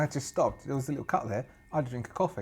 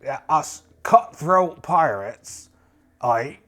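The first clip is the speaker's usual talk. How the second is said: slow, hammed-up pirate; indignant pirate captain; 6 dB louder than usual. Pirate questioning the witch's chore, slow blustering role voice. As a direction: draw out the words with pirate bluster.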